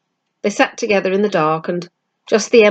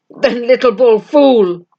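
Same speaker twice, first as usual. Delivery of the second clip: bitter; 3 semitones higher, 5 dB louder, slower than usual. A narrator's reading. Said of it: "came his bitter voice out of the dark".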